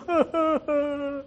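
high, drawn-out fake sob; hammed-up boo-hoo sobbing; mock crying. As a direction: wail in mock self-pity. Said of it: "sitting in a hole crying".

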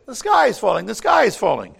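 high and loud alarmed cry; frantic yell. Acted. The birds yell their warning at Freddie Fox, high frantic yell. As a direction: shout the warning in a panic. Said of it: "everyone yells".

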